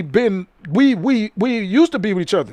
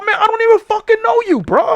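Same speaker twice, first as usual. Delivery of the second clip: much higher, louder, mock outrage; retold exasperated yell; 13 semitones higher, 4 dB louder than usual. Comic snapping at the stranger who claims friendship, exasperated mock yell. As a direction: throw your hands up in comic disbelief.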